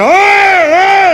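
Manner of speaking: very high wail of pain; hammed-up patient's shriek through the agony; acted pained cry. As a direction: howl as if scalded. Scalded patient crying out.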